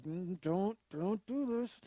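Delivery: soft, strained plea to stop; will him not to do it, under your breath; silent warning. Retold or imitated. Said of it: "we're staring at him like".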